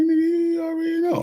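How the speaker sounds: very high-pitched mock reply; put-on squeal. Answering those who say they know him, squealed. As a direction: shoot way up into a squeaky voice.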